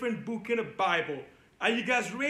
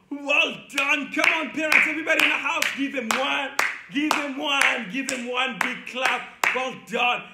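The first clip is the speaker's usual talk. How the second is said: louder, rousing call for applause; loud cheering; 2 semitones higher, 6 dB louder than usual. Real, not acted. Children's teacher urging parents to clap for kids, loud cheering. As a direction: whip up the whole house.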